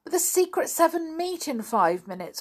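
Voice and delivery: high-pitched protest; urgent child's objection; crying out. A narrator's reading. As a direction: protest to mum in a high, anxious cry. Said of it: "cried Janet".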